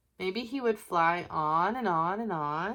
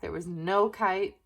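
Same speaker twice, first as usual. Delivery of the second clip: ordinary delivery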